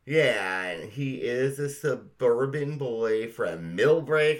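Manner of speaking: normal talking voice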